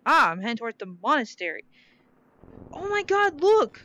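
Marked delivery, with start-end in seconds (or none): 2.7-3.8 loud, high excited outburst; high-pitched, urgent exclamation. Real, not acted. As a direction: call out an amazed discovery. Spots the footprints in game.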